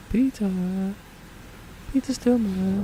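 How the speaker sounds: high-pitched call across the water. Real, not acted. Player calls out to the vanished Peter Stillman, high-pitched shout. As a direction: call his name like he's lost. Calling to game character.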